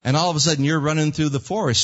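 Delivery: ordinary delivery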